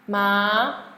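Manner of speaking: rising "ma"; second tone. Shown as a demonstration. Teacher running through four tones on one sound, "ma" rising. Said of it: "Now, M A".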